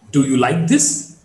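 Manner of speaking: "this" lifted at the end; rising tone. Shown as a demonstration. Teacher shows a yes-no question, rising tone. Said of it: "marked with the rising tone".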